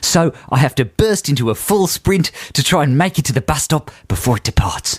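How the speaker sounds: put-on Aussie accent for a letter; comic Australian letter-reading. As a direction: read it out as a mock Australian. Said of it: "carry on in the correct accent".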